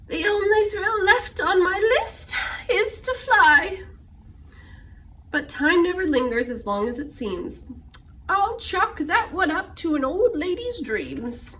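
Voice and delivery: put-on a light old aunt, a touch of longing; lightly voiced old aunt.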